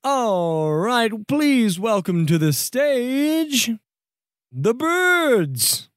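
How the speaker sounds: grand talent-show emcee; stage announcer. Acted. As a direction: introduce the big final act with stretched words. Announces the final act.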